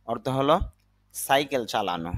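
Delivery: ordinary delivery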